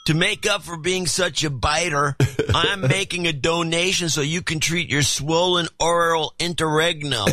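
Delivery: lightly voiced laid-back stoner; Berkeley stoner voice. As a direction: read it like a giggly Berkeley stoner. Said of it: "read this with my Berkeley stoner voice".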